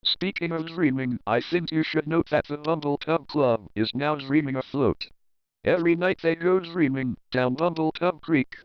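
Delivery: usual speaking voice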